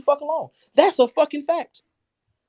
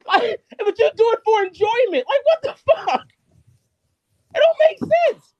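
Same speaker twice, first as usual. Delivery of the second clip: high-pitched exasperated yelling; real incredulous rant, getting higher; incredulous outburst; 8 semitones higher, 5 dB louder than usual. Real, not acted. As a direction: voice leaping up in disbelief. Exasperated at opponent's hypocrisy.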